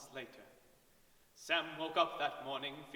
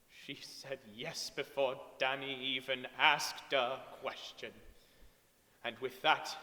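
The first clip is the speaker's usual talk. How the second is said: ordinary delivery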